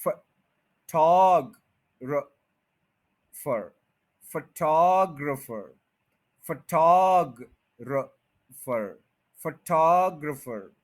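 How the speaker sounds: Photographer drawn out slowly, weight on टॉ; slow drilled photographer; slow stressed model. Shown as a demonstration. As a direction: linger on टॉ, keep the rest light. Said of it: "इन चारों को इकट्ठा पढ़ूं".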